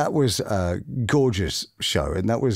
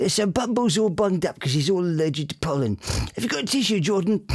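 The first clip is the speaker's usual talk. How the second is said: higher, bunged-up puppet voice; Bumble voice; 6 semitones higher than usual. Acted. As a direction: talk like a puppet with a blocked nose from pollen.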